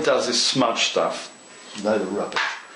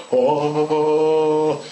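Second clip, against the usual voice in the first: a delighted exclamation, voice changed; excited oh; 2 semitones higher than usual. Real, not acted. Modeller thrilled by the greenery, exclaiming with glee. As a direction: burst out with glee. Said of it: "it's so exciting".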